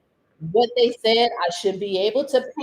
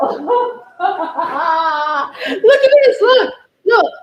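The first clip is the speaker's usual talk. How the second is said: laughing loudly while showing the mess; louder and higher, laughing through the words; real laughter; 7 semitones higher, 7 dB louder, much slower than usual. Real, not acted. Showing paint-covered hands.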